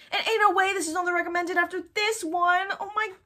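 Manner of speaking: high, loud outburst; appalled disbelief. Real, not acted. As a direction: blurt it out loudly in shock. Disbelief at the recommended item.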